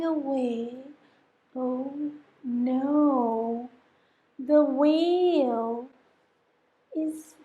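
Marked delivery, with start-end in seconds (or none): none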